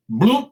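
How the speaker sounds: a high, loud plop noise; cartoon bloop of sinking into sewage; mock splash. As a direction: make the sound of falling into muck. Plunge into septic tank.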